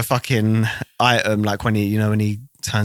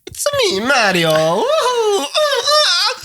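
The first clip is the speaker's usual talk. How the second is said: very high cartoon Mario with a laugh; squeaky Mario voice; much slower than usual. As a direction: squeal the catchphrase like a cartoon plumber. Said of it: "The Italians will love it".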